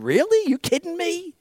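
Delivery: incredulous, as if ridiculing them; mocking disbelief. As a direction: scoff with the pitch jumping up. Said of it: "you're mocking them".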